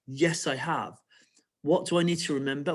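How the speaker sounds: normal talking voice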